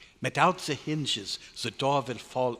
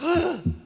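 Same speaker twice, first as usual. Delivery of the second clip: horrified gasp; 6 dB louder than usual. Acted. Doctor realises the horror.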